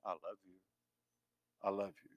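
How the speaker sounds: very soft, low loving whisper; tender whisper. Acted. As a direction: whisper it close, like into an ear. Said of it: "what God whispers in your ear".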